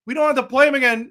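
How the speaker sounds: hammed-up relief that the enforcer is gone; mock celebrating rivals. Host mocking Oilers stars cheering a trade, gleefully. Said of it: "when they're like".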